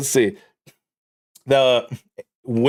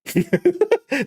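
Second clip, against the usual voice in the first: real laugh, way up high; a high, falling burst of laughter; 13 semitones higher than usual. Real, not acted. Laughing after proving his friend's point, high laugh.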